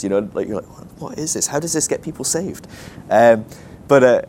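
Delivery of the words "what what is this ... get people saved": higher, softer baffled questioning. Retold or imitated. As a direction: ask it in mock bewilderment. Baffled reaction to a tract.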